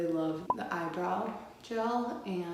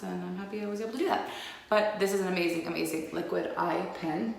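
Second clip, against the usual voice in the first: normal talking voice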